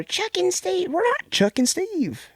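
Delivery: put-on high squeaky kid voice, yelling; mock kid yell. Retelling the kids yelling at the masked girl, shrill and high. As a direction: shriek like a scared little kid. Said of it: "She's yelling at her".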